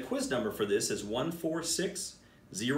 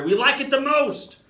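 high, louder gleeful squeal; excited monkeys; 12 semitones higher, 9 dB louder than usual. Acted. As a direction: gush about the scary book. Monkeys excited about scary book.

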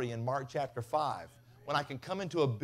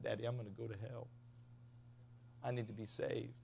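hushed plea; 4 semitones lower, 12 dB quieter than usual. Retold or imitated. Child pleading with dad at night.

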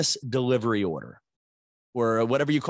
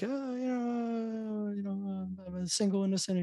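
coy dodge; 7 semitones higher, 9 dB quieter than usual. Retold or imitated. Dodging the priest's dating question, coy and high. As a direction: play it bashful, drawing out the oh.

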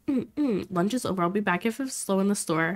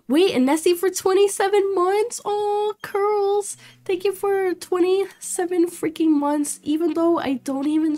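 excited thanks; 10 semitones higher than usual. Real, not acted. Thanking a long-time subscriber, thrilled and high. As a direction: gush with real surprise.

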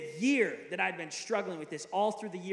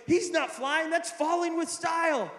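high, loud mock indignation; imitating an outraged cartoon toy; jealous toy; 9 semitones higher, 6 dB louder than usual. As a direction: protest to the other toys, hammed up. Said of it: "trying to tell the other toys".

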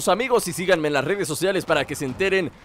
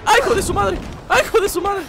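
startled cry; 12 semitones higher than usual. Real, not acted. Startled by enemy attack.

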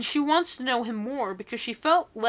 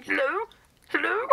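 mock hello; 8 semitones higher than usual. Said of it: "Imagine someone hears me just going".

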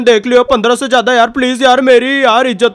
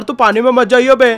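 usual speaking voice

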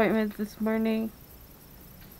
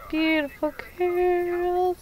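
put-on high, slow coo to a child; 9 semitones higher, 3 dB quieter, much slower than usual. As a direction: stretch it out, cooing high. Admiring child's curls.

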